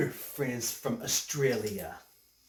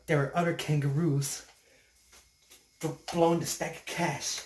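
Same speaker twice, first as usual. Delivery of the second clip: usual speaking voice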